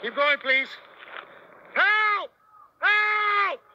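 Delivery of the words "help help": high, loud desperate call; shouting for help. Real, not acted. Calling out during arrest.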